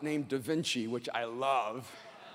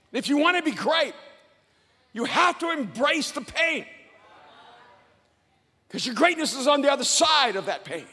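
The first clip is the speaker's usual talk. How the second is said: high, loud, emphatic preacher delivery; full-force motivational preaching; 8 semitones higher, 7 dB louder than usual. Real, not acted. Preacher urging the crowd to embrace the pain, full force. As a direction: drive every point home, raised and punchy.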